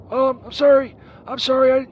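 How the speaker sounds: loud, flustered apology. Pretend. Caller apologising to the churchgoer, loud flustered sorry. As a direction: call out sorry across the street.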